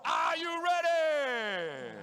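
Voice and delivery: slow, loud, stretched shout; big ring-MC "are you ready" shout; announcer hype shout. Acted. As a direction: hype the crowd before the fight. Announcer hyping fight start.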